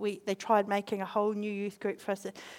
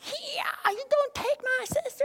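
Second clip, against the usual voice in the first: put-on very high child's challenge; childish defiance; 13 semitones higher than usual. Defending her sister, high childish challenge. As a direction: squeak it like a brave little kid.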